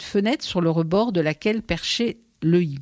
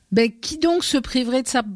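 a higher, piercing grasshopper voice; strident voice; 6 semitones higher than usual. A narrator's reading. Grasshopper scoffing at the mother in a strident voice. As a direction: chirp it out sharply, mocking the idea. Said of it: "d'une voix stridente".

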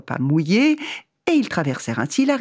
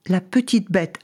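ordinary delivery

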